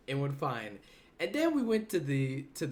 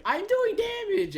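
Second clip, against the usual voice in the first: much higher, slower squeaky mock voice; mocking a teammate; 4 dB louder than usual. Teasing a teammate about the raid, high mock voice. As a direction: lampoon your buddy with a high, drawn-out voice. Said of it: "Blazy is just like".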